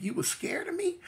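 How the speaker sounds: surprised, high-pitched disbelief; shocked question. Retold or imitated. Shocked reply to the lawyer.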